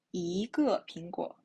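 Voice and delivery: "一个苹果" with "一" sliding upward; second-tone "一" in "一个苹果"; rising tone. Shown as a demonstration. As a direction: lift the pitch through "一". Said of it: "the rising tone".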